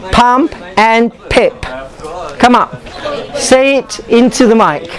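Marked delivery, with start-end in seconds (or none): 0.1-1.6 "pump" and "pip" with a puff of air on each p, slow; aspirated p demo. Shown as a demonstration. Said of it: "we have three words".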